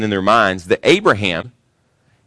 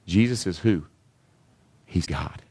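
soft, lower, slowing down; preacher softly tells the congregation Jesus is God; hushed reveal; 3 semitones lower, 6 dB quieter than usual. Real, not acted. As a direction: let the truth land quietly.

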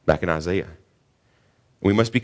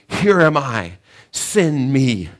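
a touch eager, a little louder and higher; 4 semitones higher, 5 dB louder, much slower than usual. Acted. Isaiah eagerly answering God's call, mildly eager. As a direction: answer keen, not bashful. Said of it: "eagerly, not bashfully".

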